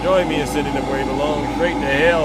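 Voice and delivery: builds to a loud, fast sendoff; loud showman host sendoff. Real, not acted. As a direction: send him off with a loud flourish.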